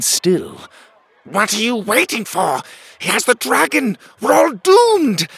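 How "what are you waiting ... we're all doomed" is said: high-pitched, frantic royal shrieking; panicked king. A narrator's reading. Panicked king shrieks at his guards.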